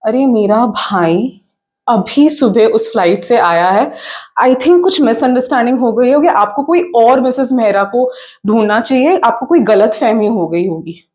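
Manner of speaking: loud, rapid stage protest; alarmed disbelief. Acted. Woman told on the phone of a plane crash, alarmed denial. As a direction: burst out fast, insisting it must be a mistake.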